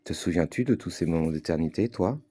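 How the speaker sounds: measured poetic recitation with long pauses; spoken-word reading, unhurried and spaced out; literary reading. Acted. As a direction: read it like a solemn prose poem, letting each phrase hang. Reads own literary text aloud.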